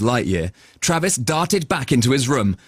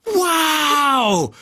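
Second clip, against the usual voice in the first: amazed; 13 semitones higher than usual. Acted. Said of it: "held on for dear life".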